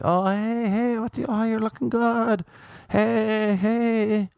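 high, sing-song come-on; flirty stripper voice. Retold or imitated. Stripper calling to a passing man, high and flirty. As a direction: call out sweetly to lure a customer in. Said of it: "they're like".

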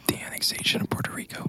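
hushed whisper; breathy dramatic whisper. Real, not acted. Naming the trick play, whispered.

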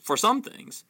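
mildly defiant narrated reply. A narrator's reading. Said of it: "said Harry defiantly".

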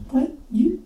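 usual speaking voice

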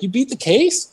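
high-pitched, delighted question; mock amazed congratulation; enthusiastic clerk. Imitating evidence clerk.

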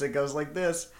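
ordinary talk

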